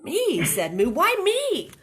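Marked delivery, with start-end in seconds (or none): 0.0-0.4 high, loud squeak of disbelief; indignant storybook surprise; startled question. A narrator's reading. Character startled at being watched. 0.9-1.7 high, loud protesting question; storybook cow alarmed and put out; dismayed protest. A narrator's reading. Character protests being watched.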